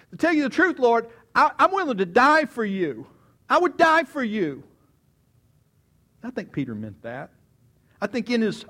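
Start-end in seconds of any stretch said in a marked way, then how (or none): 0.1-4.6 high, loud, fervent declaration; impassioned vow. Acted. Disciple swearing to Jesus he would die for him, impassioned. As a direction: swear loyalty at full voice, pitched up. Peter vows loyalty to death.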